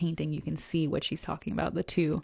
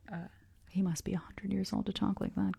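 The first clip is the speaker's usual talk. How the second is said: ordinary delivery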